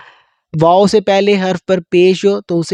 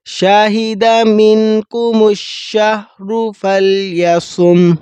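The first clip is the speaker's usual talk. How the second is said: slow melodic Quran chanting; Quran recitation; 4 semitones higher, much slower than usual. Acted. (قرآن کی تلاوت، آہستہ اور لحن کے ساتھ)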